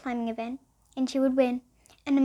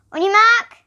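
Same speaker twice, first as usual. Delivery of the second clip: loud, high starting call; race starter call; 8 semitones higher, 12 dB louder than usual. Acted. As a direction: call the runners to the line.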